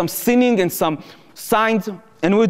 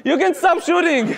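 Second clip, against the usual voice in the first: mocking chorus; 9 semitones higher than usual. Palestinians teasingly shouting along with the soldier. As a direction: echo the plea as a group joke. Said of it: "a bunch of Palestinians are coming and said".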